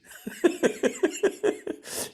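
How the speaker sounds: airy laugh; high breathy laugh. Real, not acted. Teacher laughing at her own mock scolding, airy. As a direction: laugh at the absurdity.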